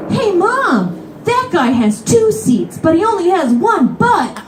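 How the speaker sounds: put-on high, loud child voice; little kid voice. Child telling his mom about the seat hog, high child voice. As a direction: chirp it out like a blunt little kid.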